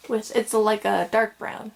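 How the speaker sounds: usual speaking voice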